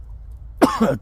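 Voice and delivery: dry cough mid-sentence; coughing fit. Real, not acted. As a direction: cough hard, then carry on. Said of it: "sorry for all the coughing".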